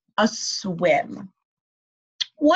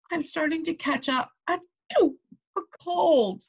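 sniffly cold voice; 8 semitones higher, 3 dB quieter than usual. Acted. Toad sneezing, catching cold.

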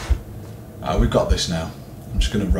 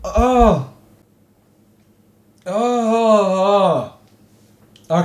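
long, high wail easing off; shaken groan; 10 semitones higher, 3 dB louder, much slower than usual. Real, not acted. Player just caught by the monster, wailing groan. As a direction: groan out the shock, drawn-out. Recovering after being caught.

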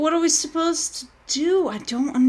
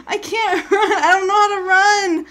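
panicked laughter; 7 semitones higher, 5 dB louder than usual. Real, not acted. Laughing panic while chased.

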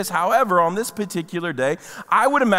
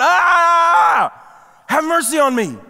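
a higher, louder desperate cry; retold louder shout; 9 semitones higher, 6 dB louder, slower than usual. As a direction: shout it all the more. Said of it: "But he shouted all the more".

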